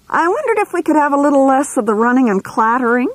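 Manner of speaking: high, quick, falsely sweet request; sugar-coat the complaint so it stings. A narrator's reading. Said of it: "with offensive politeness".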